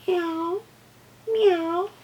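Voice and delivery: high meow like a cat. Pretend. Teacher imitates a cat for the kids, high meows. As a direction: mew up high, cat-like, rising. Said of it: "What about a cat?".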